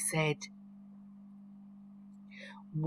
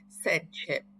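ordinary delivery

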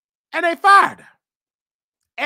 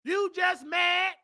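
normal talking voice

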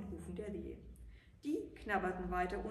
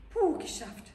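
loud, high puff of relief; exhausted gnome sighing with relief; relieved sigh; 9 semitones higher, 10 dB louder, slower than usual. Acted. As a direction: blow it out like the work is finally done. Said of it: "seufzte er".

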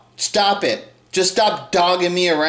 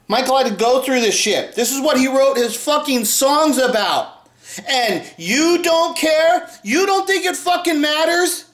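angry rant; 8 semitones higher than usual. Real, not acted. Ranting at fake fans.

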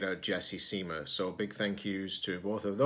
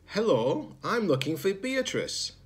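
higher, eager little robot; young robot voice; 10 semitones higher than usual. Acted. Zed asking strangers for Beatrice, higher and eager. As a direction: pitch it up like a small eager robot. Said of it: "explained Zed".

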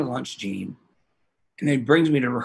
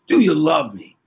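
louder retold call-out; imitated yell, moderately raised voice; 3 semitones higher, 7 dB louder than usual. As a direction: call out a song request. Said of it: "[name] yelled out".

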